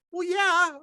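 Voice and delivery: high, loud cheeky "well, yeah"; smug admission. Retold or imitated. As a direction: toss off a shameless yes. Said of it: "I said".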